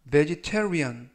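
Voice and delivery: "vegetarian" said slowly, each syllable clear; drawn-out model of "vegetarian"; slow clear pronunciation. Shown as a demonstration. Teacher models "vegetarian" slowly for Korean learners. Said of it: "이라고 발음하시면 되겠고요".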